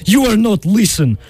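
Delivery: insistent mock Russian accent. Disguised suitor demanding the bride marry him, Russian accent. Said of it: "practiced his Russian accent".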